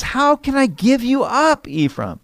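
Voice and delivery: high, tender plea from God; God pleading. Acted. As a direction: let God's heart break on "How can I give you up". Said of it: "he says".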